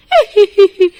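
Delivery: evil cackle. Acted. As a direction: cackle as you fly off. Witch laughs while fleeing.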